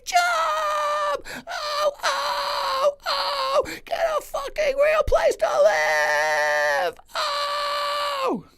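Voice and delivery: wild Kinison screaming fit; Sam Kinison scream. Acted. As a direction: shriek it at the top of your lungs. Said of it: "Sam Kinison".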